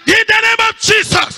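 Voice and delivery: high, ringing shout of faith; prayer climax. Real, not acted. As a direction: shout the declaration to the congregation. Prayer climax before the congregation.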